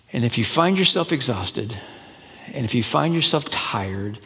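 normal talking voice

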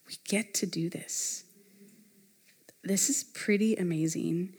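very soft, almost whispered wonder; quiet, awestruck murmur; hushed awe. Retold or imitated. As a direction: let it out under your breath, reverently. Voices awed holy-moment thought.

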